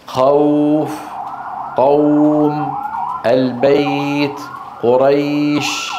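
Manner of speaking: خوف، قوم، البيت، قريش with long held glides; leen examples. Shown as a demonstration. Teacher gives students examples of the leen quality, sustained glides. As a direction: draw out each "aw" and "ay" slowly on a level tone. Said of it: "هذه صفة اللين".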